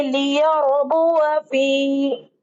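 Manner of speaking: लियरबुव फी recited very slowly, drawn out; slow tajweed demo. Shown as a demonstration. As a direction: recite it like a teacher modelling. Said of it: "देखिए". (लियरबुव फी धीमी तिलावत)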